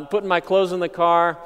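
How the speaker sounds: ordinary delivery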